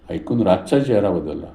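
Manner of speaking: usual speaking voice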